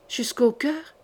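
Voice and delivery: a faint, faltering echo; a touch of a shaken young woman's stammer. A narrator's reading. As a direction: stammer it back, bewildered. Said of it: "balbutia Odette".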